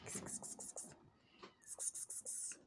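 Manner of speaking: kitty-calling hiss. Real, not acted. Calling the cat.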